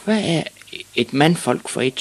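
put-on high-pitched child's voice; high, innocent question; questioning small boy. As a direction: ask like an unsure little boy. Timid Ivan asks his father.

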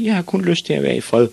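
put-on high little-boy voice; protesting boy. A narrator's reading. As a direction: plead to be left alone, pitched high.

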